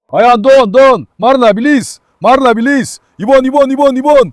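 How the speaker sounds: excited yelling to hurry Marla toward the bird; excited shouting. Real, not acted. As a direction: point and yell during the chase. Spotting the bird during the chase.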